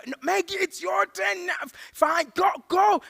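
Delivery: very high, exasperated shouting; hammed-up frantic insistence; panicked urging. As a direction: shout at a dithering driver to move. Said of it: "We're panicking at the robots".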